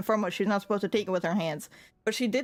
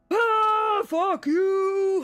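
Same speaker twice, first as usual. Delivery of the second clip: high, louder imitated teen yell; mock yell; 10 semitones higher, 5 dB louder, much slower than usual. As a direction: yell it like rowdy teens at the top of their lungs. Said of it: "like, top of their lungs just go".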